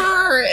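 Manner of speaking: a sudden high, loud growl; mock growl. Friend mimics a growl in a funny story, high mock growl. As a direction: growl it like a cartoon beast. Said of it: "It's like".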